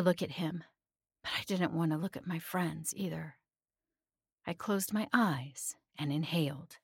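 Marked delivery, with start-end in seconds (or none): none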